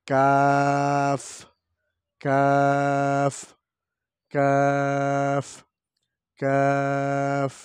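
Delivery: কাফ drawn out slow and light, thin; thin light kaf. Shown as a demonstration. Teacher contrasting light kaf for children. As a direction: say কাফ long and light, not heavy. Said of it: "চিকন করে হালকা করে পড়তে হবে".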